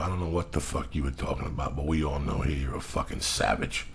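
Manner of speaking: low, soft, matter-of-fact jab; understated retold put-down; retold quiet confrontation. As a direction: keep it low and level while calling her out. Retold blunt remark to passenger.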